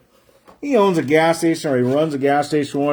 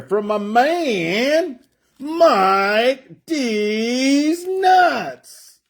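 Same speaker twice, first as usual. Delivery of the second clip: high, hugely stretched name call; drawn-out hype; 8 semitones higher, much slower than usual. Real, not acted. Card breaker announces a buyer's joke name, drawn-out hype. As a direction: draw every word out high like a hype announcer.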